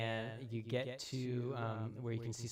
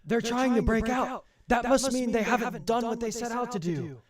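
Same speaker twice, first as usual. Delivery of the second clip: high, raised-voice call of alarm; Samuel; 12 semitones higher, 13 dB louder than usual. Acted. Samuel warns his men behind the barrier, high urgent shout. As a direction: shout a warning to your side. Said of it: "you can hear, um, Samuel saying".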